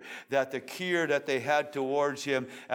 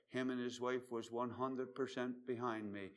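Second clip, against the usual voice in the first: usual speaking voice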